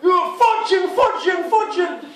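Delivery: hammed-up enraged roar; furious yell. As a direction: scream it at the intruder. Huge man charging with bat.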